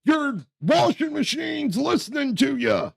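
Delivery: high-pitched crank's warning; Alex Jones. Retold or imitated. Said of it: "because he was like".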